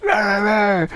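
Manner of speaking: high mock yell down the phone; mock screaming American. Imitating an American client yelling down the phone at him. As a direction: ham up a demanding caller's rant. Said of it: "they're like".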